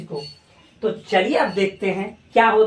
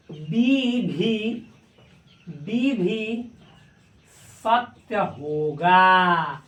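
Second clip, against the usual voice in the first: slow explaining; much slower than usual. Shown as a demonstration. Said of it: "वो कहता है ना".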